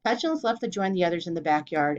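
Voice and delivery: ordinary delivery, nothing marked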